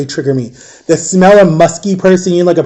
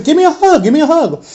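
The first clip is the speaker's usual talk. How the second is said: much higher, lightly voiced Southern lady asking for a hug; voicing a Southern lady; 11 semitones higher than usual. Public defender greeting her client, lightly voiced high and eager. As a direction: pitch it way up like an eager hugger.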